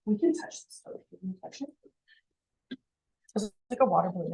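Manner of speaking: ordinary talk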